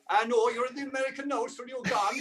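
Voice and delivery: a hint of a neighbour from the farm; Irish neighbour. Neighbour mocking the one who left, light Irish lilt. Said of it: "grew up next to you on the farm".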